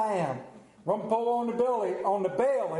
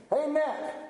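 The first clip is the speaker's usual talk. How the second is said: mock holler; 6 semitones higher than usual. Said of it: "I holler at Wendy".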